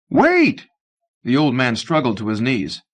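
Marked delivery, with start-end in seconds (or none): 0.1-0.7 high, loud call; old man cries out after the fleeing young man. Acted. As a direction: shout to stop him leaving. Old man calls after him.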